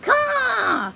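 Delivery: high, loud squawking caw; a shrill bird cry. A narrator's reading. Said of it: "Puffin's cry echoed".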